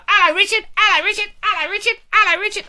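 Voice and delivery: high, loud, repeated excited greeting; same eager fan voice. Pretend. Fans pouncing on the host, high excited greeting. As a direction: chant the greeting like a crowd of identical fans. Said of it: "all in the same voice like that".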